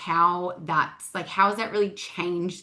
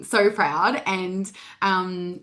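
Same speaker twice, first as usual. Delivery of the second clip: ordinary delivery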